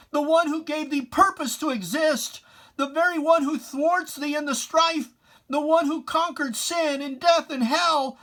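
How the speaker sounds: impassioned outcry. Acted. Poet rebuking the conquered villain, loud and impassioned. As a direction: proclaim it like a thundering preacher. Climax of recited poem.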